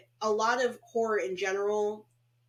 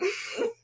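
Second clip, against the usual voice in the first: high laugh. Real, not acted. Laugh at the V-neck joke.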